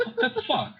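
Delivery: laughing what the fuck. Retold or imitated. Confused reaction quote.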